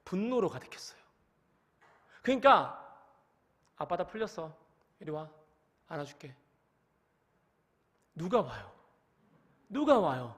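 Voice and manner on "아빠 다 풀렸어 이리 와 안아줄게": soft, cold words with anger still under them, much softer; forgiving words, angry tone. Shown as a demonstration. Father still angry, offering a hug to his crying child, cold and quiet. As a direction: say you forgive while still fuming. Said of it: "내 표정과 내 모든 상태는 분노가 아직 안 풀렸음을".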